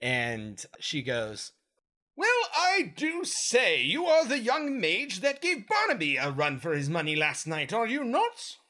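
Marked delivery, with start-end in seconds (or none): 2.2-8.6 a proper lady soldier, pitched way up; female Gith voice. Acted. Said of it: "This is my female Gith voice".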